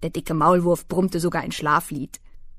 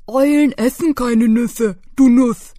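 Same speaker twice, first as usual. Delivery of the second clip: laute Maulwurfstimme; Maulwurf; 4 semitones higher, 9 dB louder than usual. Acted. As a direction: sag es laut und vorlaut als Maulwurf. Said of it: "sagte der Maulwurf". (Maulwurf belehrt das Eichhörnchen, laut)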